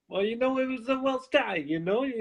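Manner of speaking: put-on New York accent. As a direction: play an old-school cop with a New York accent. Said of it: "why does he have a New York accent".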